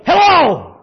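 loud, high, furious hello bellowed down the phone; roared hello. Retold or imitated. Angry man answering the third call with a roar. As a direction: blast the greeting like a furious man. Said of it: "it roared".

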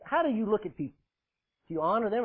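normal talking voice

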